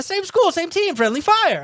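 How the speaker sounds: high-pitched retold protest; pissed off. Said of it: "I was pissed".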